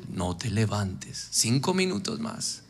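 low, slow, soft murmur of temptation; lazy dark voice coaxing you to stay in bed; dark tempting voice. Acted. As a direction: drag it out low like a lazy devil. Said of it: "voz oscura de las tinieblas".

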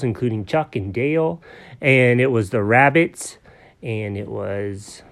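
ordinary delivery, nothing marked

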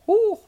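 very high put-on "ooh"; high falsetto ooh. Shown as a demonstration. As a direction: hoot it way up in falsetto. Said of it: "either British or Mickey Mouse".

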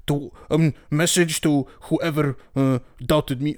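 put-on a slow, half-hearted try at a Finnish accent; badly faked Finnish accent on the team radio; failed Finnish accent. Imitating Bottas on team radio in a half-hearted Finnish accent. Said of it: "I can't even do a Finnish accent".

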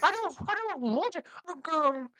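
high, drawn-out mock Irish brogue; terrible Irish brogue. Mocking the movie's Maggie, hammed-up Irish accent. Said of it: "while you do the accent".

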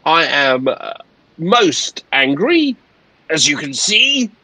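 high, slow, pompous complaint; indignant captain. Acted. Captain explains being undermined by Cunard, slow indignant pomp. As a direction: draw out key words with wounded authority. Plays the angry captain at length.

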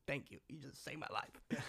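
soft, grateful, almost under the breath; hushed relieved gratitude; quiet heartfelt thanks. Retold or imitated. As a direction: thank him like he saved your life. Thanking cop who stopped beating.

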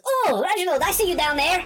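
high, sing-out villain taunt. Acted. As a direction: call out to the prey you have spotted. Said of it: "And he says".

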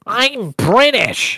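high, very drawn-out mock whine; Chad. Host mocking Chad's claim to be British, in a high stretched voice. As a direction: imitate him, swooping up and stretching both words. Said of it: "Chad goes".

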